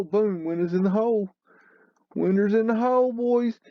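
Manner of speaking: gleeful high call to the audience, windows in the hole; excited. Real, not acted. To his viewers, a high excited call.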